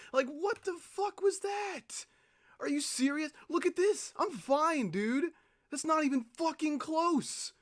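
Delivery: high-pitched incredulous cry; real disbelief, yelped high; outraged disbelief. Real, not acted. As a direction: gamer shocked at an unfair death. Disbelief at the failed run.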